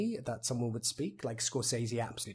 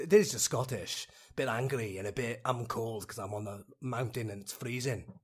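a bit angry Scottish accent; Scottish, as if cold on a freezing mountain. Shown as a demonstration. Actor suddenly finds his Scottish, slightly angry Scottish accent. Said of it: "suddenly I found it".

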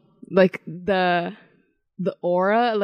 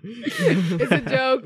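laughing; 6 semitones higher, much slower than usual. Real, not acted. Laughing while explaining the joke.

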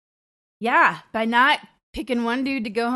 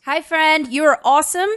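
higher, louder, bubbly hello; excited greeting; 7 semitones higher, 8 dB louder than usual. Acted. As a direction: greet him like a cheerleader. Cheerfully greeting the caller.

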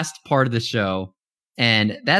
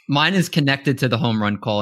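ordinary delivery

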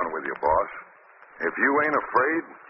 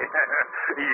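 mocking laugh; 2 semitones higher than usual. Villain mocking friendship.

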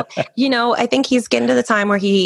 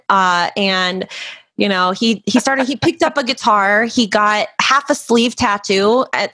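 usual speaking voice

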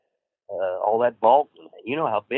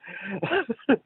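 laughing; 12 semitones higher, 4 dB quieter than usual. Real, not acted. Laughing at story.

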